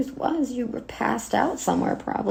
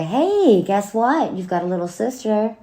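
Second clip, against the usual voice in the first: sarcastic sing-out, high and loud at both ends; mock cheerful announcement; 4 semitones higher, 4 dB louder than usual. Daughter imitating absent mother showing up at the door, mock cheerful. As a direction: fake breezy surprise, punched at the end. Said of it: "showing up at the door".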